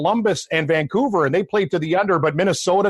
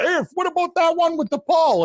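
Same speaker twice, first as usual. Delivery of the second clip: high mock whiny fan voice; nagging fan; 12 semitones higher than usual. Co-host mimics a pestering Twitter fan, high and whiny. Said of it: "we're on Twitter all day".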